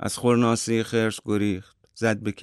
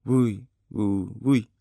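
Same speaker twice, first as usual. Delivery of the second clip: bird's song. A narrator's reading. (تقلید آواز کشیدهٔ پرنده)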